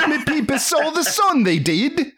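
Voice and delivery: high, laughing, hammed-up Cockney; mock Cockney. Host capping the dream speech spoof, mock Cockney. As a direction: squeal out a silly Cockney line through laughter. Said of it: "everything but Cockney".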